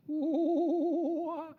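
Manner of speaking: high, loud whooping bird call; sudden high bird whoop; kookaburra call. Retold or imitated. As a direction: mimic the kookaburra's cry. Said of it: "kookaburras make that".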